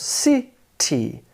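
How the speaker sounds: CITY split into two separate syllables, ci, ty. Shown as a demonstration. Teacher counting out the syllables for learners, CITY said in two separate beats. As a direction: break CITY in two with a clear gap. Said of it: "It has two syllables".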